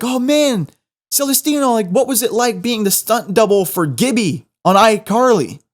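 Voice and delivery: high-pitched imitation of a gushing fan; mock starstruck. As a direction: pitch it up, playing an eager admirer. Acting out fans accosting him.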